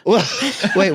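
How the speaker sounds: ordinary delivery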